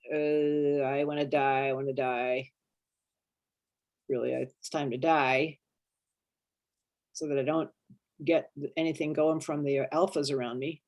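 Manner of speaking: low, soft voice of giving up; acted despair, pitched low and drained. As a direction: sink down into hopeless murmuring. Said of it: "move out of despair".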